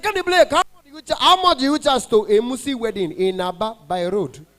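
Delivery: imitating the high-voiced caller. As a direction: pitch it up like a broke caller. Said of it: "their voice is very high".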